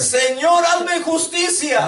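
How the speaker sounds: high, loud cry for justice. Shown as a demonstration. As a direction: call it out to the Lord. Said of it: "Más fuerte todavía".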